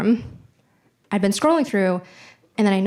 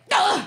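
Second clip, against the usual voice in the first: frustrated ugh; 12 semitones higher than usual. Retold or imitated. Storming off from mom, exasperated high ugh. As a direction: groan like a fed-up teenager. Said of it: "I was just like".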